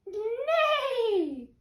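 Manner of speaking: high, loud, long whinny; whinny for all you're worth; neighing with all might. A narrator's reading. Goose imitating a horse to fool thieves, mighty neigh. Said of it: "with all her might".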